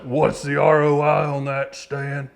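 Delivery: put-on drawn-out good-ol'-boy Southern accent. Client asking Stan about returns, in a Southern voice. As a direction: put on a Southern twang for the question. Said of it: "in a good Southern voice".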